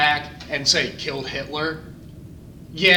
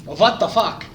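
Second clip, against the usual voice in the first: Hitler impression that sounded like Dracula; 4 semitones higher, 5 dB louder, slower than usual. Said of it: "And he's like".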